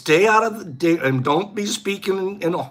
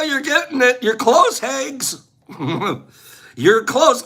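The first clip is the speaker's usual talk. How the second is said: normal talking voice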